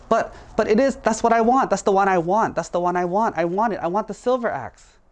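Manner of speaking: put-on high, insistent childish pleading; whiny protest. Dishonest woodcutter demands the silver axe, whiny protest. Liar protests at being refused.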